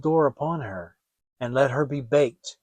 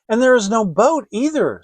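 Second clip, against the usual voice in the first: worried; 6 semitones higher, 6 dB louder than usual. Acted. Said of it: "said Gretel".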